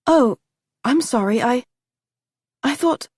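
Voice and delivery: put-on girl caught out, stammering; startled girl. A narrator's reading. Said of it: "Molly looked startled".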